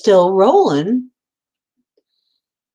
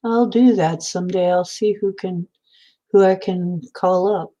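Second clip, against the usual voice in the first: ordinary talk, nothing marked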